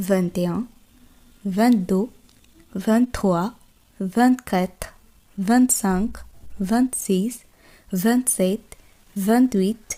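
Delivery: spaced French numbers for students to echo; repeat-after-me counting. Shown as a demonstration. Teacher leading students to repeat, slow and clear. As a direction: say each one clearly and wait. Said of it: "আমার সাথে বলুন".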